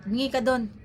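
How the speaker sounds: snippy, clipped-off retort; curt mock order. Rude cashier curtly orders the customer to ask elsewhere, snippy. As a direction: bark it out like a rude clerk. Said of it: "nagsungit na siya".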